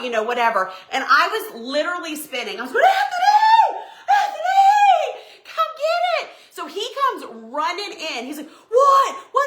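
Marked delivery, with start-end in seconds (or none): 2.8-6.3 high, drawn-out screamed summons; screaming for husband. Retold or imitated. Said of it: "start screaming for my husband". 8.5-9.5 loud, high-pitched shout of alarm; alarmed husband. Retold or imitated. Husband runs in answering screams.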